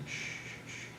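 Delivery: unvoiced whispered shush; hushing. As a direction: hush her softly, breath only. Hushing his sister in the yard.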